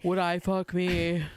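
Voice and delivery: low, slow sassy drag drawl. Retold or imitated. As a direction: camp it up like a queen. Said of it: "Your drag voice is so good".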